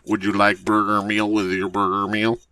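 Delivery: muffled intercom imitation; drive-thru speaker voice. Drive-thru worker upselling the customer, distorted intercom voice. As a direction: talk like a distorted order speaker. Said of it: "the voice on the drive-thru said".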